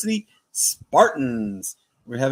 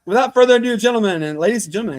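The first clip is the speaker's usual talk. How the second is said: loud, higher showman's build-up; announcer intro; 5 semitones higher, 6 dB louder, faster than usual. Acted. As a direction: present the guest like an arena announcer. Host introduces the guest.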